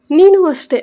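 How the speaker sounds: sudden high, loud jealous snap; a jealous friend flaring up at him; angry outburst. Acted. Jealous friend scolds Mayur.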